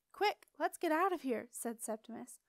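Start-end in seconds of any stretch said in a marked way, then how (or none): 0.1-1.5 urgent. A narrator's reading. Fleeing the shadow.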